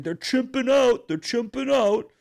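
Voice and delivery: high, loud sneering parody voice. Retold or imitated. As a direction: throw his own words back at him, hammed-up and loud. Owner mocks the racist's words.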